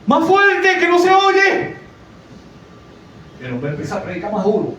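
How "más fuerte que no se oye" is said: put-on high, loud shout of an old woman from the back pew; old lady yelling. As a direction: yell it up to the preacher like a deaf old lady. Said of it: "Y la viejita decía".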